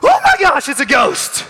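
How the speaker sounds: very high, loud mock panic; hammed-up ghost scare, high and loud; panicked shriek. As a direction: shriek it like a terrified man in the back. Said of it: "some poor guy from the back goes".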